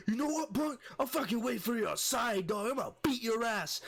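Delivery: very high mock-angry drunk; drunken threat. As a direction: imitate a riled drunk issuing threats. Drunk threatens a fight, retold.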